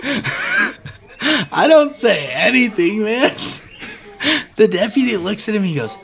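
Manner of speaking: normal talking voice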